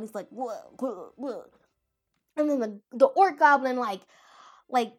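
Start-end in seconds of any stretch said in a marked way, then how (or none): 0.3-1.4 imitated gurgling retches; cartoonish throaty spewing noises; spewing blood. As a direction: gurgle out repeated mock blood spurts. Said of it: "he's, like, spewing up blood".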